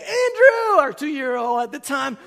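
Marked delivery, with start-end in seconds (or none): 0.0-0.8 high, loud excited call. Retold or imitated. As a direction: call the toddler over happily. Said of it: "I'm like".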